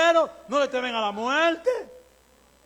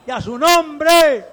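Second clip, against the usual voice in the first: slow, loud shouted proclamation; preaching shout; 3 semitones higher, 11 dB louder, slower than usual. Real, not acted. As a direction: cry it out slowly to the church.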